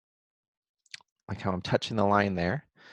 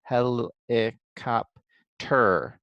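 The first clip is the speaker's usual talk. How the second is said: "Hel, eh, cop, ter" in four separated beats; syllable by syllable; much slower than usual. Shown as a demonstration. Teacher reviews syllables while writing, part by part. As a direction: say each syllable apart, landing on "ter". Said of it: "doing my syllable writing".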